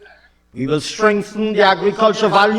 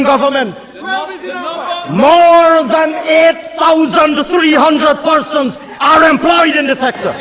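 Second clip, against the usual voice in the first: fiery oratory; 6 semitones higher than usual. Acted. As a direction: rail at the opposition benches.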